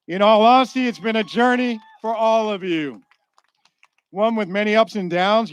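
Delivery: normal talking voice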